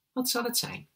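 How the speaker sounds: high, light shopkeeper's voice; ice-cream lady. A narrator's reading. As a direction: ask it high, serving a customer. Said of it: "vroeg ze".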